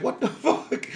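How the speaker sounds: high, laughing what-the; laughing disbelief. Retold or imitated. Man seeing himself in the mirror, high laughing disbelief. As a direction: break into a laugh mid-curse in amazement. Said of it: "looking in the mirror like".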